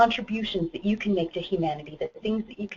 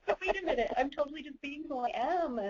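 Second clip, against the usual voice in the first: higher, softer mock realization; dawning realization; 8 semitones higher, 7 dB quieter than usual. Retelling her moment of self-acceptance, soft surprised realization. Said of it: "I'm like".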